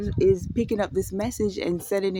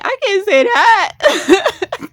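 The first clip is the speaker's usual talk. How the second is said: very slow, high and loud through laughter; real giggling, drawn out; laughing; 13 semitones higher, 11 dB louder, much slower than usual. Real, not acted. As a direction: squeal it out mid-laugh. Laughing at own words.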